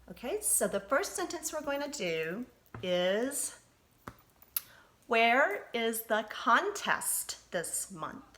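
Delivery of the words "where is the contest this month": slow, word by word dictation. Shown as a demonstration. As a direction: read it out slowly for the class to write. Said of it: "say that with me".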